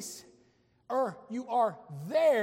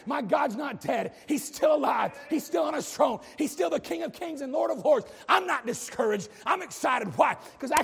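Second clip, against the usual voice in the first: triumphant preacher's shout; shouted praise; 3 semitones higher than usual. Real, not acted. Triumphant sermon climb.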